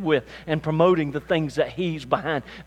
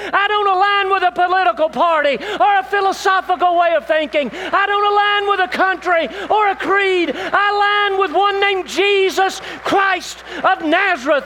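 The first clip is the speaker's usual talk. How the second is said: proclaimed aloud; 9 dB louder than usual. Acted. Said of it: "with the loudest voice we can say".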